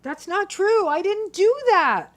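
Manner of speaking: high, loud protesting cry; insisting loudly you never did it; agitated shout. Acted. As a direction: shout your denial, worked up and defensive. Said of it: "your volume increases".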